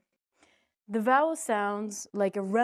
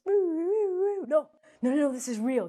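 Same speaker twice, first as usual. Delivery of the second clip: mock insistence; 7 semitones higher, 6 dB louder, slower than usual. Teacher insisting it's real.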